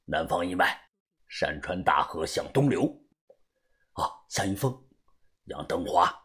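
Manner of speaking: very low, quiet secret reply; low-voiced go-between, keeping it discreet; middle-aged contact man. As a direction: answer the password in a deep hushed voice.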